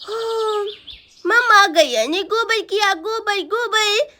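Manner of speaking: put-on childish voice with drawn-out words; tattling little boy. Boy tells mamma about the cow dung, childish tattling. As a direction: lisp it like an excited small child.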